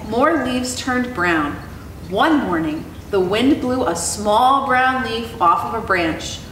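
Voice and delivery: plain reading voice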